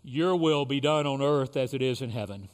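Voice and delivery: leading the Lord's Prayer with long pauses; short phrases with pauses. Real, not acted. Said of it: "I will say a phrase".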